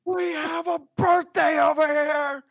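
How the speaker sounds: very high, loud hall-wide shout; booming announcement. Acted. Friar Hugo announcing the birthday to everyone, booming shout. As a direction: call the whole hall to attention.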